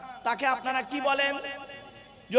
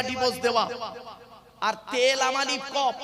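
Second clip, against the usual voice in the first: ordinary talk, nothing marked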